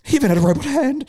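high teary wail; mock sobbing. Crying to girlfriend about Luke's hand, high mock sob. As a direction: blubber it out high. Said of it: "I'm like".

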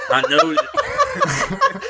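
high cackling laugh breaking through; headless horseman laugh. Real, not acted. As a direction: burst into a shrieking laugh mid-line. Reading the story, breaks up laughing.

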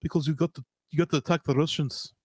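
lightly put-on Russian accent; a hint of a Russian accent; bad Russian accent. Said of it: "This is not my good Russian accent".

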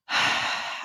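long audible sigh out; breathy, weary exhale; heavy sigh. Retold or imitated. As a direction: sigh as if sensing trouble. Acts out her past uneasy reaction.

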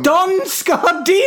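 high, drawn-out incredulous cry; indignant outburst. Real, not acted. Co-host corrects host's mangled name, indignant high outburst. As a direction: blurt the name in high-pitched disbelief.

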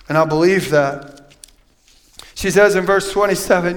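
ordinary talk, nothing marked

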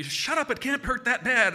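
high, loud, slow exasperated yell; mom yelling. Retold or imitated. Mom yells at her bleeding son, exasperated. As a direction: scold at full voice, imitating a mother. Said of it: "She's yelling at me".

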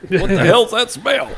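high, loud and slow, hammed-up; mock disgust. Friend joking about the fart smell with hammed-up disgust.

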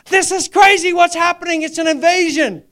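high, loud shout of disbelief; astonished shout. Retold or imitated. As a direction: shout it, laughing at the wonder of it. Reacting to brother's conversion.

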